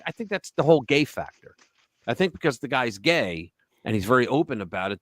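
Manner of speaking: normal talking voice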